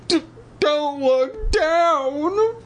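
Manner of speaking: slow, very high quavering stammer; trembling voice. A narrator's reading. Andy warning Evan on the ledge, trembling voice. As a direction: stutter and wobble in exaggerated fear. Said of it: "instructed in a trembling voice".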